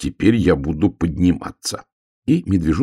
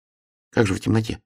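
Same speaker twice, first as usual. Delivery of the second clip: higher little hedgehog voice; lightly voiced, pitched-up hedgehog; puzzled hedgehog; 5 semitones higher than usual. A narrator's reading. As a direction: ask as a puzzled small hedgehog. Hedgehog questions bear in story.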